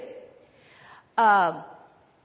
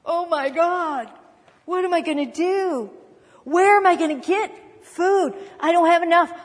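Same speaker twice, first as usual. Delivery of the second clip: mock panic, pitched up and louder toward the end; panicky worrying; 4 semitones higher, 5 dB louder than usual. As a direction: act out the frantic worrier, rising into alarm. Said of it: "this is how we start talking".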